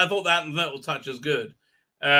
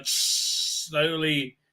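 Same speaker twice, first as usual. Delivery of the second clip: ordinary talk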